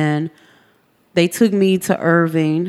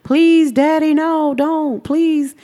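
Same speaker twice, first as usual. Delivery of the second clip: put-on high, frightened kids begging; a child's pleading voice, pitched high; children pleading; 8 semitones higher, slower than usual. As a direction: voice the kids crying out for their father to stop. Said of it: "I can hear my kids".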